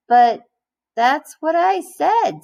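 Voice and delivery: put-on high, slow whiny kid insisting; protesting boy Joe. A narrator's reading. Teacher reading aloud, voicing a confused boy protesting. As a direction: plead like a confused little boy. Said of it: "said Joe".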